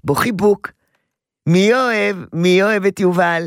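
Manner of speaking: put-on sing-song toddler talk. Shown as a demonstration. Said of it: "להגיד לו, כמו לשיה".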